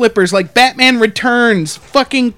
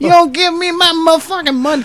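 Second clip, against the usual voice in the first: high-pitched mock yell; retold screaming threat; 6 semitones higher than usual. Drunk man yelling at a woman by phone, mock scream. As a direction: imitate a drunk man demanding cash. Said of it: "he's screaming at her".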